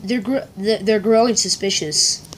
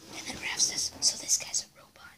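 whispering; 15 dB quieter than usual. Acted. Whispered prompts.

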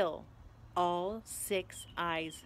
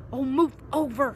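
impatient, louder and higher command; snarled; 4 semitones higher, 6 dB louder than usual. A narrator's reading. Hermione to Ron at locked door, impatient snarl. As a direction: snarl it, fed up. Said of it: "Hermione snarled".

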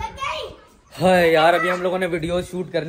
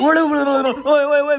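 high, loud frantic shooing; yelling to scare the bug off; shooing shout; 12 semitones higher, 6 dB louder than usual. Real, not acted. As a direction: shout it away in a panic. Shooing insect off himself.

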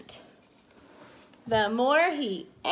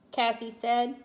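ordinary delivery

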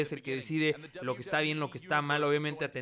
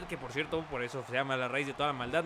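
usual speaking voice

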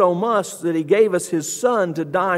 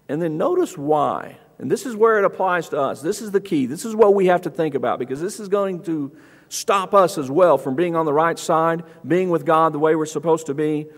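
ordinary talk, nothing marked

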